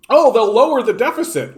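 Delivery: mocking a deficit hawk; mock voter quote. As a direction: parrot the line like a smug fool. Mocking a voter's talking point.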